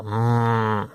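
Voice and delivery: normal talking voice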